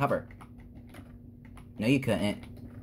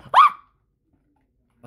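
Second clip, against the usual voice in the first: sudden high shriek, far above the usual voice; startled scream; 10 dB louder than usual. Real, not acted. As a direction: let out a sharp high yelp of panic. Scream at losing the game.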